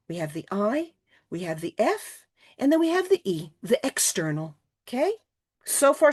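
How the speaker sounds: ordinary talk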